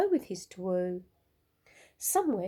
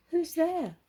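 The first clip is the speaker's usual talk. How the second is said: higher, curious owl voice; little owl asking; 8 semitones higher than usual. A narrator's reading. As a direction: call out lightly, wondering. Olive asks who answered.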